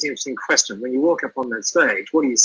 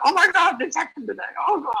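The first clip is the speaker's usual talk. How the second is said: stressed fretting; 14 semitones higher than usual. Retold or imitated. Acting out a stressed person panicking about the day, much higher mock fret. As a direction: play the worrier fretting over a bad day. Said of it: "rather than sort of stress about".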